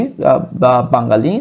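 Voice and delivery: usual speaking voice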